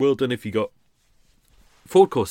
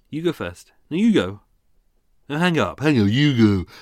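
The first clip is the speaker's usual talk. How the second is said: playful lovers' hang-up routine; hurried goodbye; 6 dB louder than usual. Pretend. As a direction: tease like a couple who won't hang up. Mock lovers won't hang up.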